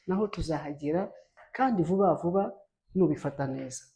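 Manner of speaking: normal talking voice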